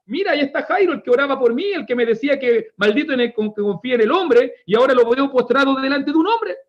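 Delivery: high-pitched voice of an incredulous bystander; scandalized onlooker. Acted. Crowd member pointing out Jairo bowed before a man, scandalized.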